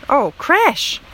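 ordinary delivery, nothing marked

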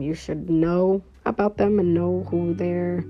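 usual speaking voice

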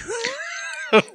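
high shrieking laugh; burst of laughter. Real, not acted.

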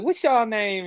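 high-pitched Southern lady voice; Southern drawl. Retold or imitated. Uber driver asking a passenger's name, high Southern drawl. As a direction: imitate a Southern woman asking sweetly high. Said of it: "she's like".

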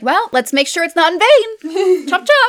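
hammy carefree evil; mock callous villain. Friend quoting a movie villain, high hammy voice. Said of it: "and then he's like".

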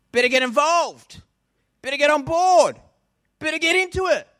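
amazed new believer. Acted. Aussie character meets Jesus.

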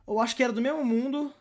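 usual speaking voice

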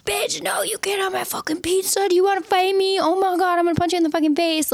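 hammed-up tough girl picking a fight; territorial threat. Retelling the pizza standoff, high mock fury. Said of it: "And I was like".

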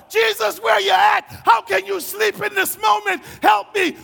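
loud, high desperate cries; frantic, pleading holler; freaking out. Acted. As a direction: shout a panicked plea for help. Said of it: "I'm freaking out".

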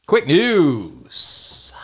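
loud, high sing-song segment call; podcast segment bumper, hammed up; segment announcement. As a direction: belt out the segment title like a jingle.

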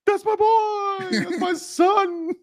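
very high, slow, crying wail; mock sobbing dad. Telling cohost how they now imitate the crying dad. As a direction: ham up the weeping father. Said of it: "we now walk around and be like".

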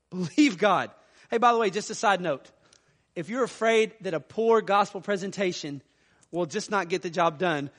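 normal talking voice

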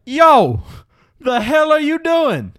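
loud, high, drawn-out yell in an imitated accent; attempted Steve Smith accent. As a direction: shout it in a put-on accent, confronting someone. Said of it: "I tried to do an accent".

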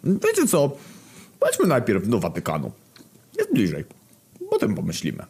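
lightly voiced character; a hint of a put-on voice. Postać Głód mówi. (lekko zagrany głos postaci)

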